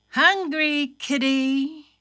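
usual speaking voice

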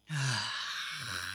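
long, soft fed-up sigh; big brother's put-upon sigh; reluctant sigh. A narrator's reading. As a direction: breathe out in quiet resignation. Thumper resents watching his sisters.

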